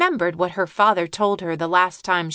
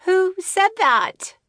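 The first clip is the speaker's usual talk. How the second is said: much higher, slow and wondering; startled girl; 12 semitones higher, 3 dB louder, slower than usual. Acted. Jenny startled by a talking squirrel, high and slow. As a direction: ask in wide-eyed surprise.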